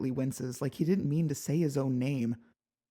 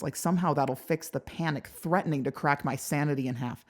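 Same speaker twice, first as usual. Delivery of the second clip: plain reading voice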